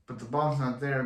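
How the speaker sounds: ordinary delivery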